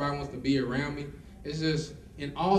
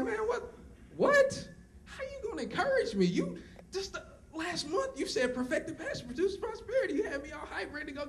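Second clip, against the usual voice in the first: much higher, squeaky, half-laughing disbelief; incredulous outburst. Retold or imitated. The speaker arguing back at his mentor, high incredulous protest.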